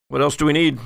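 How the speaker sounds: ordinary talk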